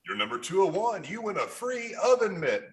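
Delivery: playful, swooping game-show delivery; mock prize announcer. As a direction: announce a prize like a radio host. Mock contest announcer prize patter.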